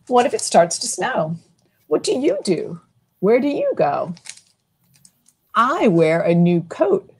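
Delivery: lilting picture-book read-aloud; reading to children. A narrator's reading. Librarian reading a snow book to kids, lilting. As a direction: read it to a room of little kids.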